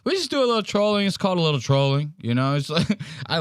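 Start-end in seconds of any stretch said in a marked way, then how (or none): 0.0-2.1 higher, faster imitation of his catchphrase; Donald Trump impression. Host mimicking Trump's soundbite for the stream, playful. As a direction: toss it off in a mock Trump voice. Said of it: "You know how Donald Trump says it".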